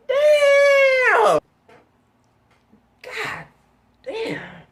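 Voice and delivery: sudden high, loud cry of "Damn!"; hyped outburst. Real, not acted. Telling friends the story, shouts an overexcited outburst. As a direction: yell it out, overcome with excitement. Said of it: "I'm getting a little too hyped".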